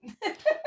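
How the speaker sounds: cracking up at her own joke; high laugh. Real, not acted. Woman laughing after her cheeky remark, high laugh.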